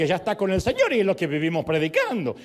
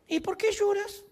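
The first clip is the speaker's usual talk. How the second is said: high-pitched mock-angelic voice; angel's question; 13 semitones higher than usual. Angel asks the weeping woman at the tomb, comically high. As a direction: ask it in a comically high, put-on voice. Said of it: "que se ve que aprendió del maestro".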